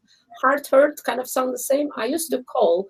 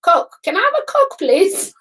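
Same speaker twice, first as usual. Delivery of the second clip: high and fast, eager order; high quick ordering; 8 semitones higher, 3 dB louder than usual. Retold or imitated. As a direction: rattle it off high. Repeated bar order mimicry.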